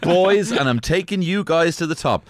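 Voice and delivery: loud, high mock rallying cry; hyped-up show host. Hosts riffing on Sister Act, mock hype.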